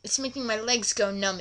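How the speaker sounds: ordinary delivery, nothing marked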